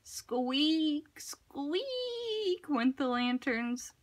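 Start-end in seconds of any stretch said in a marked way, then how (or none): 0.0-2.7 higher, softer thin squeaking; swinging lantern creaking. A narrator's reading. Lantern sound in story.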